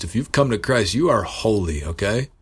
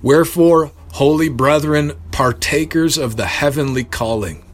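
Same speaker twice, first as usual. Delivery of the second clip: solemn scripture reading; 5 semitones higher than usual. Shown as a demonstration. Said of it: "I'll just end with this scripture".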